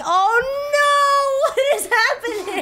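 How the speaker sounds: high laughing shriek of disbelief; real squeal of surprise; shocked laughter. Real, not acted. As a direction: cry out in amused shock. Shocked by the contraption.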